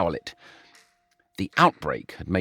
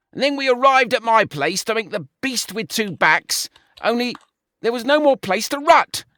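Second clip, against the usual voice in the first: much higher, self-pitying lament; wailing; 9 semitones higher, 3 dB louder than usual. A narrator's reading. George lamenting his lost date to Brian, wailing. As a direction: whine like a jilted pig. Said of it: "wailed George".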